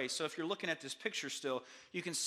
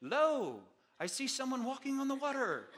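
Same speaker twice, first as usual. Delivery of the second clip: put-on high, stately old-time proclamation; hammed-up archaic announcement; mock King James formality; 5 semitones higher than usual. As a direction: declaim it like stilted King James English. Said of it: "like in King James English".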